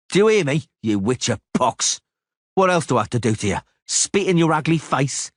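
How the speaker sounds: high sneering taunt, mockery rising; Cicada jeering. Cicada mocking the witcher about his woman, sneering high taunt. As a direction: needle him until he draws. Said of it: "narrator voicing Cicada".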